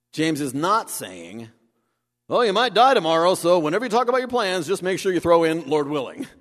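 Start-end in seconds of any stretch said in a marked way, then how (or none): 2.3-6.3 higher, louder, fast mocking paraphrase; mock advice. Preacher mocking a shallow rule, hurried and hammed-up. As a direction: rattle it off like a glib rule. Mocking misreading James is not saying.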